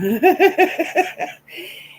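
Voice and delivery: high, loud burst of laughter; big laugh. Real, not acted. Streamer cracking up at her own story, laughing hard.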